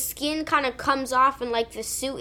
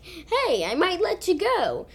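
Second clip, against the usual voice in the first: higher, singsong good-cop imitation; high-pitched good cop; 6 semitones higher than usual. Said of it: "talking in this high-pitched".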